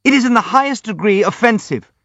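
high, squeaky indignation; high-pitched outraged protest; squeaking. A narrator's reading. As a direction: squeak it out, offended. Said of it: "squeaked Hippolyte".

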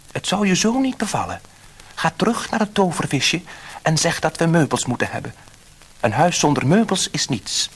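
a demanding wife listing what she wants; a hint of a bossy wife. A narrator's reading. Wife sends husband for more.

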